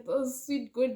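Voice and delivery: ordinary talk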